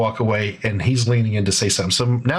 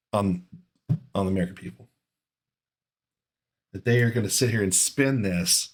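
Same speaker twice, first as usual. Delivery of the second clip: ordinary talk, nothing marked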